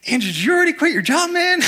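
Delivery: high, fast, panicky question; nervous. Retold or imitated. Young founder nervously asking the new hire, high and fast. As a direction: blurt it out in a nervous rush. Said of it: "I was so nervous".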